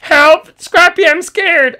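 very high, loud, slow frightened squeak; scared Sing Song. Acted. Sing Song begging Scrappy for help, very high and scared. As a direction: cry for help in the tiny voice.

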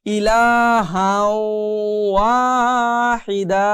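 slow recitation, "ইলাহাও" hummed into long "ওয়াহিদা"; merged ghunna, madd. Shown as a demonstration. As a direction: stretch the vowels and nasal w of "ইলাহাও ওয়াহিদা". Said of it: "মিলিয়ে পড়তে হবে".

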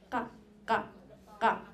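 ka popped out without lung air; sharp glottal ka, ejective. Shown as a demonstration. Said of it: "when you're doing the b and the".

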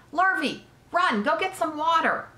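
high, pressing order to a helper; urgent calling out. A narrator's reading. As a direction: call across the ring for help. Owner calls helper for water.

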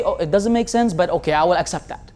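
resigned mock acceptance. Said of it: "you have to say".

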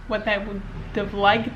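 ordinary delivery

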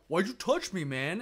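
hammed-up drunk snapping at a teen employee; retold drunk guy yelling at the kid; mock angry drunk. Drunk snorer confronts theater kid.

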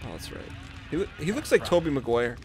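ordinary delivery

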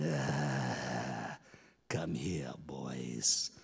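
very low, drawn-out croak fading to whisper; menacing goddess. Acted. Fiery goddess beckoning two chiefs, low menacing voice. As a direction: beckon with slow, deep menace.

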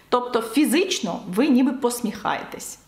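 usual speaking voice

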